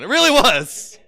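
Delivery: laughing through the words. Real, not acted. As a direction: crack up at your own joke. Laughs at own joke.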